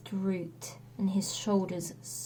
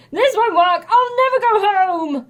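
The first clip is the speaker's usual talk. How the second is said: loud, higher frustrated outburst; impatient hero; 10 semitones higher, 9 dB louder than usual. A narrator's reading. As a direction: stamp it out in despair. Hercules voiced in story.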